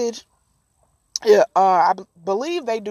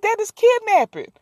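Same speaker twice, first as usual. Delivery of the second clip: shocked friend; 7 semitones higher than usual. Retold or imitated. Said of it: "Her friend was like".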